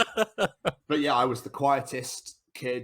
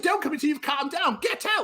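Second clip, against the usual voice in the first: high mock shout; mock teacher yell. Teacher throwing Tom out of class, high mock yell. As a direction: squeal the order like a furious teacher. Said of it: "I said".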